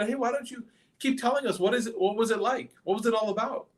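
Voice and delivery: high-pitched, goading questions; mocking. Crowd urging the Prophet to keep telling, mockingly curious. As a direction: egg him on with feigned curiosity. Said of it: "good chance to mock the Prophet".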